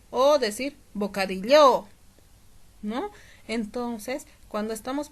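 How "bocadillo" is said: misplaced final stress on LLO. Shown as a demonstration. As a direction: punch the end of "bocadillo", bocadiLLÓ. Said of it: "No es lo mismo decir".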